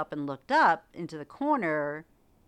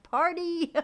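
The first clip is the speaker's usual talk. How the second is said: high, loud, drawn-out whoop; mock party cheer; 13 semitones higher, 5 dB louder than usual. Orbs imagined gathering at the lights, playfully cheering.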